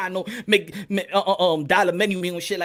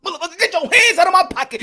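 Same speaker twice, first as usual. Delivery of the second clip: loud, higher, retelling a movie line; movie quote shout; 9 semitones higher, 7 dB louder than usual. Retold or imitated. Quoting Malcolm X in the movie, loud. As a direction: bark it like the movie scene. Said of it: "The dude said".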